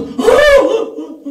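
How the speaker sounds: hammed-up sudden sob, high and loud; mock sob. Preacher acting out a worshipper bursting into tears, mock sob. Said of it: "눈물이 터져버린 거야".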